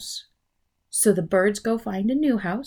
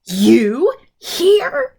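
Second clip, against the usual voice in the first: loud, high startled gasp; 6 semitones higher, 9 dB louder than usual. Acted. Mister Bird finding his wife alive, startled gasp. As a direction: gasp it in disbelief. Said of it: "gasped Mister Bird".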